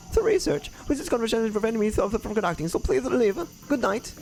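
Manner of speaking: high, hurried brush-off; dismissive researcher. Acted. Researcher turning away callers at the door, dismissive.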